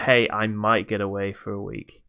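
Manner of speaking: ordinary talk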